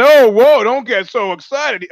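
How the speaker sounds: high, loud mock protest; mock placating. Coach's side telling critics to settle down, mock placating. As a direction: jump up in pitch, pretending to calm everyone down. Voicing excuse-makers calming critics.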